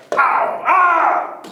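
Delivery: explosive smack, high yell; loud hit then cartoon shriek; pow and scream. A narrator's reading. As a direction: burst out the blow and the cry.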